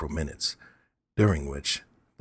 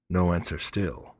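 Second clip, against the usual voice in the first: usual speaking voice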